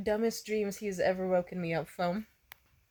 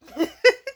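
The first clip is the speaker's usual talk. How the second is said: loud high laugh; 10 dB louder than usual. Retold or imitated. Laugh inside [name] quote.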